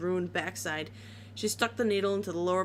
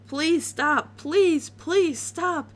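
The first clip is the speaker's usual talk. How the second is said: higher, louder desperate pleading; crying and begging; 9 semitones higher, 7 dB louder, much slower than usual. A narrator's reading. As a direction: beg her to stop, near tears. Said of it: "Dash burst out crying and begging".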